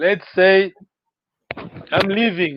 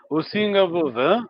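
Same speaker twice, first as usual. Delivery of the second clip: ordinary talk, nothing marked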